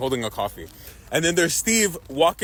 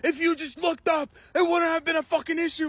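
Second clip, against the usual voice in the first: retold yelling; 9 semitones higher than usual. Ben berates Steve for not looking up, in a high mock yell. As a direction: imitate someone scolding, voice held high. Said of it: "Ben starts yelling, being like".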